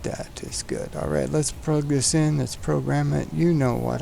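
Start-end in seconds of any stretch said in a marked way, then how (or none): none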